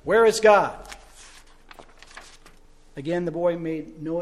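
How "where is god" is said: louder booming demand; the pastor presses the question loudly; loud stern repeat. A narrator's reading. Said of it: "the pastor again repeated the question".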